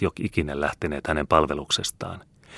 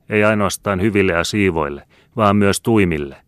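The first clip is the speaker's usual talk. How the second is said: raised, loud scripture recitation; catechism reading; 3 semitones higher, 5 dB louder than usual. A narrator's reading. Girl reading the catechism to servants, declaiming. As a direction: read it out loudly as holy command. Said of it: "luki niin kuin oli siitä tapana".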